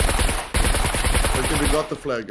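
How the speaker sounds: loud, breathless cheer; excited shout. Real, not acted. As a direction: shout out the win. Capturing the flag.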